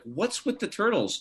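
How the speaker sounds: high, slow put-on wife voice; exasperated wife. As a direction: mimic a wife baffled by the clutter. Wife fed up with turtle gifts.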